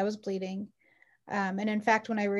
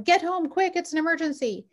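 urgent call; 7 semitones higher than usual. Retold or imitated.

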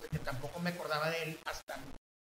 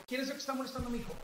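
raised pitch, quick, controlled question; firm, without shouting; 7 semitones higher, 4 dB quieter, much faster than usual. Retold or imitated. Father confronting the kids at the gym, firm without shouting. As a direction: demand it fast and high but don't yell. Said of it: "no grité".

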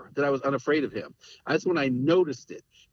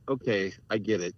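put-on deferential kids; 7 semitones lower than usual. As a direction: play the kids giving in politely to their father. Kids answering father respectfully.

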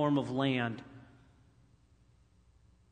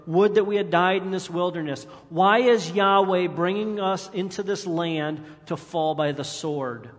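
loud, fast, higher grumbling wail; fast mock complaint; 4 semitones higher, 6 dB louder than usual. Preacher acting the Israelites' complaint, loud and fast. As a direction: rush it out in a whine.

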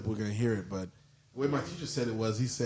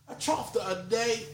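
put-on higher-pitched playful Aussie accent; put-on Australian accent; mock Australian accent; 8 semitones higher than usual. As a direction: try out a jokey Australian twang, pitched up. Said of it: "I think I'm Australian".

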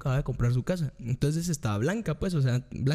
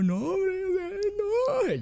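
high drawn-out mock wail; mock crying; slower than usual. Man waking beside a stranger, high mock crying. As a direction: cry it out in comic despair. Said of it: "Yo llorando".

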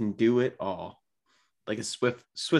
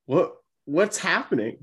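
higher-pitched baffled quote; baffled reaction; 7 semitones higher than usual. Retold or imitated. Podcast host recalling fans watching the catcher's hot streak, baffled. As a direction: act out the fans' astonishment. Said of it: "we were just like".

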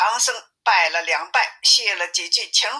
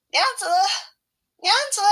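calling out; 11 semitones higher, much faster than usual. A narrator's reading. Monk at the lattice hailing the demoness, calling out. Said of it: "叫道".

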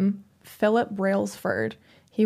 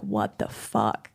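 low, slow, drawn-out disbelief; stunned disbelief; 12 semitones lower, 5 dB quieter, slower than usual. Retold or imitated. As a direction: let it drop out in shock. Retold reaction to police lights.

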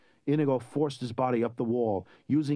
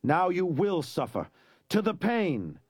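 high, slow cry of threat; 7 semitones higher, slower than usual. Acted. Wesley crying out at the rising prince, high and slow. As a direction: hurl the threat upward. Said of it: "Wesley cried out".

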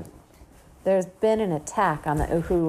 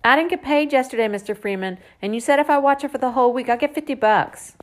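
put-on loud, high, fast girl's protest; big-mouthed complaint; 8 semitones higher, 7 dB louder, faster than usual. A narrator's reading. Girl demanding babysitting pay at the front door, loud-mouthed. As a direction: holler up a rapid-fire demand for pay. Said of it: "big mouth at the front door".

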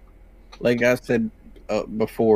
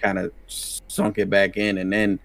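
ordinary delivery

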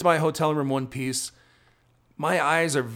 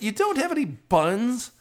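high-pitched incredulous question, hammed up; mock astonishment, pitched way up; retold disbelief; 9 semitones higher than usual. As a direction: repeat it back as a baffled customer. Retells disbelief at no buns.

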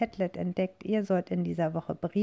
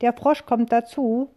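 high, loud witch call; 5 semitones higher, 5 dB louder than usual. A narrator's reading. Witch welcoming the frog aboard, high and loud. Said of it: "Die Hexe ruft".

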